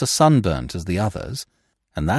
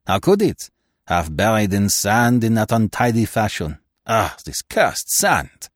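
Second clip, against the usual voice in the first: Poirot. Acted. Detective complaining to his companion about the sand, lamenting. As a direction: grumble in a foreign accent, slowing into exasperation. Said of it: "I cut short his lamentations".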